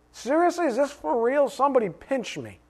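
high, amazed disbelief; can this be real, wonder aloud; astonished disbelief. Acted.

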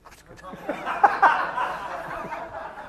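high airy laugh; real laugh at his own joke; breathless laugh. Real, not acted. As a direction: laughs at himself before the congregation.

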